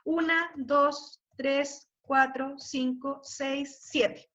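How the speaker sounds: conteo lento, sílaba por sílaba; contando sílabas. Shown as a demonstration. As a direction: contar despacio cada sílaba. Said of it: "ponerle a todo O". (contando sílabas despacio)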